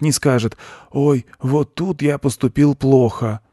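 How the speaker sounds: preaching goat. A narrator's reading. Goat explains his doctrine to a boy, preaching.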